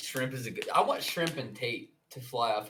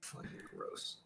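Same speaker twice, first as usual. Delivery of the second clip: whispered disgust; 21 dB quieter than usual. Real, not acted. Reacting to gross passage.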